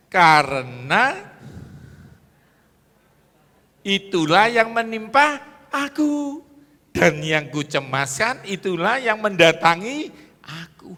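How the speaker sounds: loud, high, drawn-out verse reading; emphatic scripture reading. Acted. Preacher reads the verse to the congregation, loud and emphatic. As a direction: proclaim the verse forcefully, stretching the words.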